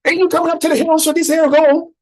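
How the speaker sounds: high-pitched, hammed-up hillbilly prospector accent; terrible put-on accent. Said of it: "my accents are terrible".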